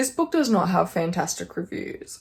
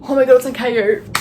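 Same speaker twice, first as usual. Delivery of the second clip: louder, bright burst of joy; thrilled it's actually there; delighted discovery; 4 semitones higher, 8 dB louder, faster than usual. Real, not acted. Finds book available.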